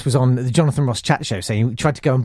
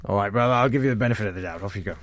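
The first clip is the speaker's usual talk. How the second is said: low, quick grudging dismissal; relenting teacher; 6 semitones lower, 5 dB quieter, faster than usual. Acted. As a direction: let the pupil off under your breath. Acted teacher relenting to pupil.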